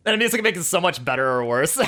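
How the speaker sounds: laughing through the words. Real, not acted. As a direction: giggle while you say it. Laughing aside about music.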